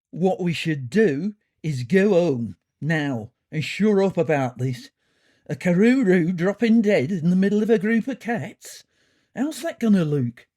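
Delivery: worked-up character voice, emphatic; indignant character urging a retreat; gruff cat character. A narrator's reading. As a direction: lay down the law to the group.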